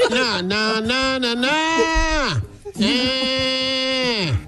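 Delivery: mock moaning. Imitating Miss Beverly moaning to Herman, high hammed-up moans.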